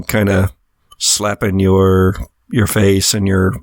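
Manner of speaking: normal talking voice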